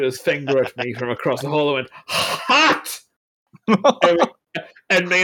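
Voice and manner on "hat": high, breath-heavy mock holler; calling out. Irishman calling across the hall at a stranger, mock shout. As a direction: imitate a yell from far across a room.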